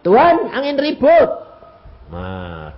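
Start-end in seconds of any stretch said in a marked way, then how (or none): 0.0-1.3 loud, high cry of alarm; acted urgent shout; disciples' cry. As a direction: imitate disciples calling out in the storm. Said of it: "persis kayak murid-murid".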